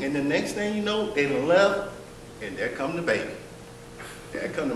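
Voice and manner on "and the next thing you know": ordinary talk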